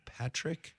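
"Patrick" with crisp T and released final K; careful enunciation. Shown as a demonstration. Host showing off clear diction, enunciated "Patrick". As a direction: sound every consonant of "Patrick". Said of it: "you can hear the K?".

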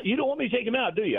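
high-pitched imitation of a testy question; retold challenge. Manager challenges coach about pulling pitcher, imitated high-pitched challenge. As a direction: retell it as an indignant challenge.

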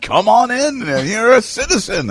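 high, hammed-up welcoming voice; sarcastic mock greeter. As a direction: sell the absurd invitation sarcastically. Mocking open-border greeter, sarcastic.

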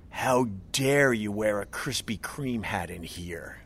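slow hushed whisper. Retold or imitated. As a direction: breathe it out slowly, almost silent. Retold barista parting shot.

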